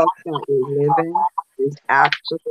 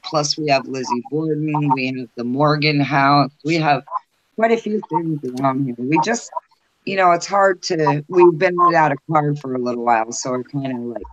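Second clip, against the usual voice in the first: ordinary talk, nothing marked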